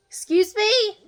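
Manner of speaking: high, loud, incredulous Excuse me; bristling at the stepdaughter's outrageous claim; indignant disbelief. Real, not acted. Stepmom hearing the accusation, loud and high with disbelief.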